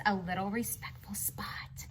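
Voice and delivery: chuchotement mystérieux; souffle-le comme un secret; whisper. Acted. Repeating the title with suspense.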